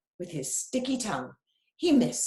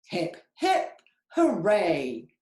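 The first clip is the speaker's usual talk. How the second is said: cheering; 5 dB louder, slower than usual. Acted. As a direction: cheer him on with joy.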